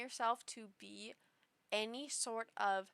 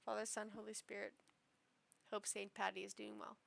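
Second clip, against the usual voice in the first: mock prayer murmur; 3 semitones lower, 8 dB quieter, faster than usual. Crossing herself for Saint Patty.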